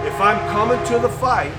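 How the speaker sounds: loud, high declaration; loud resolute promise; firm vow. Retold or imitated. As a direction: proclaim it like a vow. Repeated vow, emphatic.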